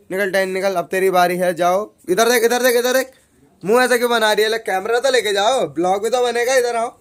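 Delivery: high, loud excited orders; loud bossing. Real, not acted. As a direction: hurry her along loudly. Loudly bossing the new seeker.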